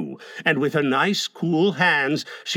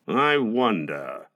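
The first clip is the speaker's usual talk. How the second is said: slow, lowered musing; thoughtful; 6 semitones lower, 4 dB quieter, slower than usual. A narrator's reading. Husband doubts the nurse dog, thoughtful. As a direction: muse on it slowly in a low voice. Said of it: "Mister Darling said thoughtfully".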